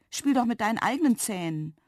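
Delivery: plain reading voice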